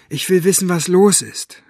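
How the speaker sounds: high, loud, defiant demand; angry girl demanding answers; calling out. A narrator's reading. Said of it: "rief Maggie".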